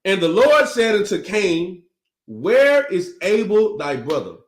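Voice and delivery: slow, measured Bible reading; scripture reading. A narrator's reading. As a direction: read the verse out slowly, with weight. Reads the scripture aloud.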